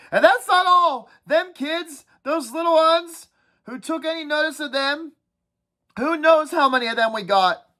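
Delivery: whiny schoolboy nagging the group, pitched well up; Piggy's voice. Acted. Piggy voiced in read-aloud.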